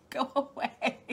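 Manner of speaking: slow, laughing brush-off. Real, not acted. Joking to chat about bugs, laughing go-away. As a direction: shoo them off while laughing. Laughing at insects that love her.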